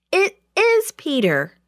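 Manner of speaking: high, upset insistence with stress on "is"; high-pitched, mildly tearful protest. A narrator's reading. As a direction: plead it, upset at being disbelieved. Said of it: "said in a tearful voice".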